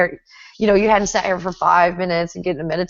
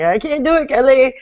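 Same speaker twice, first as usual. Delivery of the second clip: higher-pitched imitation of a frustrated student; mock complaint; 7 semitones higher, 4 dB louder than usual. Student telling Kelly they can't do it, mock complaint. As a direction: whine it as a stuck student would.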